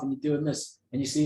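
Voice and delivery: usual speaking voice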